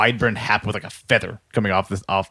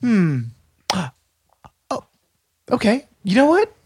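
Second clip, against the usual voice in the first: high officious woman voice; 4 semitones higher than usual. Acted. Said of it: "She says".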